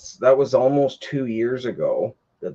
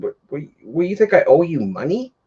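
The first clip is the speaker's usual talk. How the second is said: higher, sputtering angry retort; snapping at him; 6 semitones higher than usual. Retold or imitated. To the neighbour who wants money for unfinished work, angry snapping. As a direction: tear into him for the unfinished job. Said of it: "I just immediately snapped".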